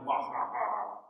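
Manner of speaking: mock evil laugh, low and soft; greedy villain's wah-ha-ha, trailing down. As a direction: gloat with a quiet fake villain cackle. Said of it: "That's how greedy villains act in stories".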